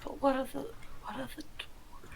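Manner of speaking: hushed, urgent-to-know question; whisper. Acted. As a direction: whisper the question back to the scout. Answers the whispered scouting report.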